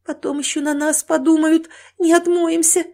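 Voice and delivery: high-pitched anxious worry; frightened wife's rising warning; horrified. Acted. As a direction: fret fearfully about being blamed. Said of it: "в ужасе сказала жена".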